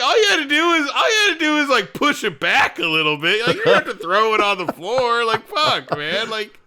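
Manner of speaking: high, loud exasperated rant through laughter; cracking up while scolding the character; laughing exasperation. Retold or imitated. Friend retelling a film scene, laughing and exasperated. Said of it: "I was like".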